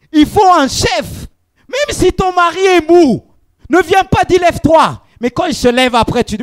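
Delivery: fervent shouted exhortation; preaching at full force. Acted. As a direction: hammer the point home. Preaching on the husband as head.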